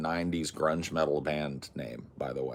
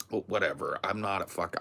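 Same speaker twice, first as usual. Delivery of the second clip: normal talking voice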